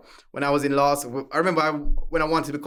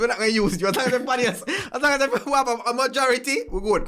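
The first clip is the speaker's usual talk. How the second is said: Jamaican accent, retold; 8 semitones higher, faster than usual. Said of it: "I said".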